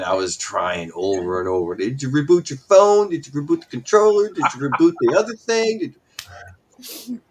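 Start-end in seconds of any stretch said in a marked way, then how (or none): 1.8-7.2 imitating pestering friends, higher and louder; mock ribbing. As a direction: rattle off mock troubleshooting questions, raised and insistent. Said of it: "you guys were ribbing me pretty hard".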